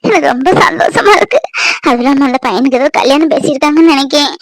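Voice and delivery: normal talking voice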